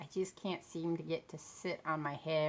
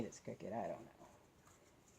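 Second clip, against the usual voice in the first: muttered; 10 semitones lower, 15 dB quieter, much slower than usual. Real, not acted. Muttered aside about being crooked.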